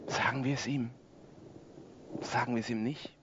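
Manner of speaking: hushed question. Acted. Asking whether to tell the preacher.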